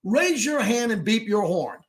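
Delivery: punchy, emphatic sports-talk rant; sports radio monologue. Real, not acted. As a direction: launch out of the gate and drive the monologue like a live radio host. Hosts live sports radio monologue.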